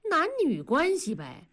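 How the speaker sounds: high, cocky comeback; smug. A narrator's reading. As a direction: toss it off proudly. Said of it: "得意地说".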